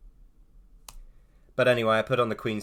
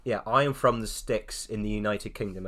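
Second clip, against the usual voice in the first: ordinary delivery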